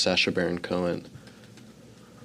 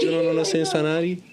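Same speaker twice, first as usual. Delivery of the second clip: rapid, high hammed-up Belgian-Cuban accent; fast mock Belgian-Cuban accent; 9 semitones higher, faster than usual. Son teasing his father in the car, fast mock Belgian-Cuban accent. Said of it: "Belgian-Cuban person".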